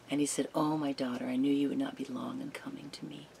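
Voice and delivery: soft, near-whispered devotional reading; hushed reverent telling. Acted. Preacher closing the story for the congregation, hushed and reverent. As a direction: draw listeners close with a hushed voice.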